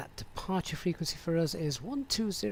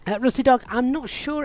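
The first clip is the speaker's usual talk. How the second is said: calling out; 7 semitones higher, 10 dB louder than usual. Real, not acted. Calling out to chat viewer.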